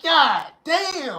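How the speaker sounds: high stunned "God damn"; awestruck god. Retold or imitated. God seeing goddesses step off the ship, high awed exclaim. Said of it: "The God gonna be like".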